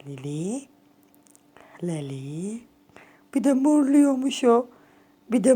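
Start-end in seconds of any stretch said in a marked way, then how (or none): 0.1-0.6 very soft, low call of the name. Real, not acted. Owner softly calling her cat Lili by name. 1.8-2.6 breathy whisper of the name Lili. Real, not acted. Owner whispering her cat's name Lili. Softly calling the cat.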